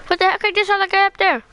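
high startled exclamation. Real, not acted. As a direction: caught off guard by a sudden enemy. Spots an enemy suddenly.

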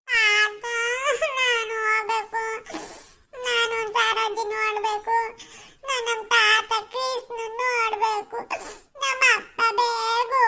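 put-on slow, whining, sing-song kid's sob; child whimpering. Child crying to grandpa to be taken to see dad, whimpering. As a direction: whimper it like a child about to cry. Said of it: "ಯಾಕಪ್ಪ ಅಳ್ತಿದ್ಯೆ?".

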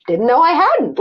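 a mild, puzzled surprise; surprised. Retold or imitated. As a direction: answer as if caught off guard. Surprised reply to his remark.

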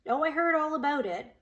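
mocking, snide know-it-all tone. A narrator's reading. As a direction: say it with a snide edge. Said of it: "the girl said snidely".

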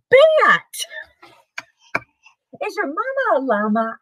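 ordinary delivery

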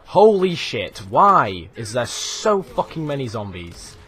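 loud, drawn-out complaint; exasperated outburst. Real, not acted. Gamer swamped by zombies, loud exasperated outburst. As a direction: burst out loudly, stretching the words.

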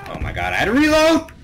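loud, high frantic yell; panicked shout. Real, not acted. Caught reloading in firefight.

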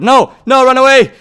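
loud, high-pitched alarm; urgent, high shout; panicked yell. Real, not acted. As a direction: yell it while fleeing in a game. Fleeing an armed opponent.

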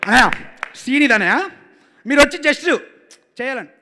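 imitating director. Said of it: "ఇతను బాగా imitate చేస్తావు".

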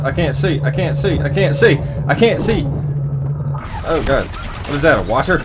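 panicked, high and loud; frantic while fleeing machines; being chased. Real, not acted. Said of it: "they're chasing me".

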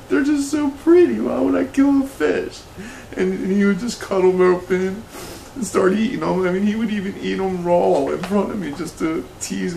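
very high, distraught wail of a voice; hammed-up emotional breakdown. Host reliving dad's fishing trips, melodramatic high-pitched breakdown. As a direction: pitch shoots up like an upset child pleading.

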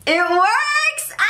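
high cry of joy breaking into a laugh; delighted relief, laugh it out; thrilled laugh. Real, not acted. Vlogger trying the glasses on and seeing clearly, laughing with joy.

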